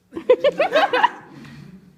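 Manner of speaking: loud, high, bitter burst of laughter. Acted. Man mocking his own love affair, laughing bitterly. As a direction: laugh scornfully at himself.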